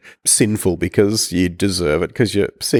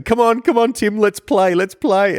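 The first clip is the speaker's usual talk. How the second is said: high, eager nagging friend; eager Ferris voice; 13 semitones higher than usual. Retold or imitated. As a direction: pester him to come play, high and bright. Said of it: "you're like Ferris, who's saying".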